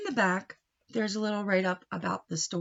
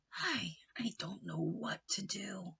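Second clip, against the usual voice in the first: soft, slow hushed; despairing whisper; 11 dB quieter than usual. Acted. Uncle Nacho whispers his worry to Ambrosia. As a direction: let it fall away helplessly.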